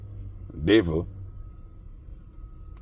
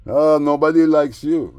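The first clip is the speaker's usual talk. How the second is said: higher, louder, slowed sneer; mocking taunt; 7 semitones higher, 6 dB louder, slower than usual. Mimicking the neighbor jeering that nobody likes him, slow sneer. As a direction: sneer it like a playground bully.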